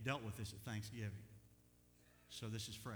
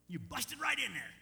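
indignant protest; 10 semitones higher, 7 dB louder than usual. Acted. Acted protest at God prying.

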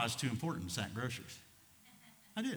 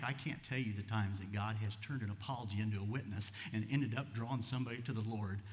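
usual speaking voice

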